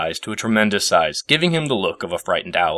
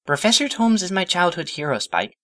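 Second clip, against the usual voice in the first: put-on high, dreamy young mare voice; fond and far away, remembering a hero; wistful Twilight; 11 semitones higher than usual. A narrator's reading. Twilight tells Spike about her hero, high and wistful. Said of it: "She spoke wistfully".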